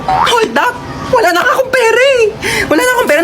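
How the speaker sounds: high crying whine, no money; high sobbing plea; whiny wail. Acted. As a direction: whimper and plead to the robber. Robbery victim wailing.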